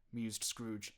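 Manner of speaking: ordinary delivery, nothing marked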